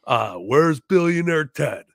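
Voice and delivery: put-on slow, taunting Cuban-accented drawl; Razor Ramon impression. Fan quoting Razor Ramon's taunting promo, slow and accented. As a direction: sneer the names out slowly. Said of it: "infamous moment of Scott Hall getting in that ring".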